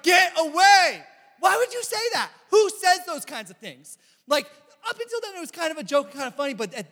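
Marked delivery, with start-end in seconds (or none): 0.0-4.0 loud, high indignant yell at a friend; outraged shout. Retold or imitated. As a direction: shout him down, shocked and angry. Friends confront the parade-raining friend.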